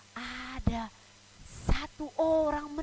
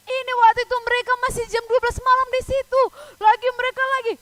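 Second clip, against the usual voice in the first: put-on higher, louder, fast storytelling for children; pendongeng heboh; 10 semitones higher, 11 dB louder, faster than usual. A narrator's reading. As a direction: tell it urgently to wide-eyed kids. (mendongeng dengan suara tinggi, lantang dan cepat)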